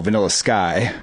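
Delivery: ordinary talk, nothing marked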